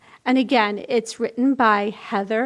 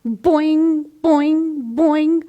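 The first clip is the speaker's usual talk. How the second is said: boing, boing sung out higher and louder like a spring; bouncy boing sound; 4 semitones higher, 4 dB louder, much slower than usual. A narrator's reading.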